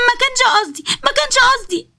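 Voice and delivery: put-on high, whimpering childlike voice, near tears; high weepy voice protesting it wasn't on purpose; tearful pleading. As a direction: plead through sobs like a scolded child. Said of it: "سومة فضلت تعيط".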